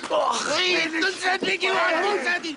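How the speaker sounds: shouting in anger over the smashed car; furious shout. Acted. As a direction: blow up at him. Car wrecked, confronting the driver.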